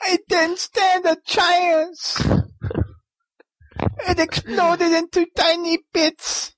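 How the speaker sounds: put-on hillbilly accent. Mock eulogy in accent.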